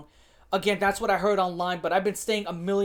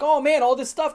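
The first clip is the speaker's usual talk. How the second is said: imitating excited companies, higher and louder; mock excitement; 7 semitones higher, 7 dB louder than usual. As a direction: play an executive thrilled by the find. Voicing greedy companies seeing online hype.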